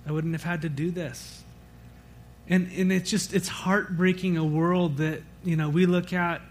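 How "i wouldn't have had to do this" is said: soft, subdued retelling of a grieving woman; hushed, understated lament; retold weeping mother. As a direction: quote her grief softly, without real crying. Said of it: "weeping and weeping and weeping".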